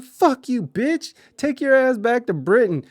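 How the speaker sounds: high, loud yell at him; drunken yell. Retold or imitated. Drunk boyfriend screaming at the British roommate, loud yell.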